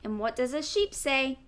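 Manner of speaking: plain reading voice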